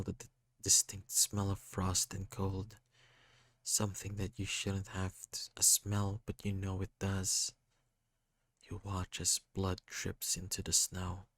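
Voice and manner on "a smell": plain reading voice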